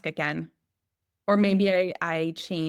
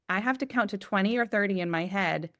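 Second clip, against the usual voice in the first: ordinary talk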